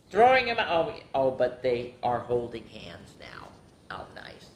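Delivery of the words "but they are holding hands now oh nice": hushed real whisper; soft delighted whisper over the picture. Real, not acted. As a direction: breathe it out like a secret.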